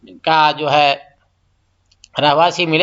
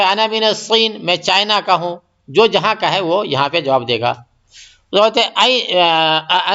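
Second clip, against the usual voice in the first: normal talking voice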